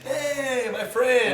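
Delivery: high, drawn-out mock Portuguese-accented greeting. Instructor welcoming a beginner, mock Portuguese accent. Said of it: "with their little Portuguese accent".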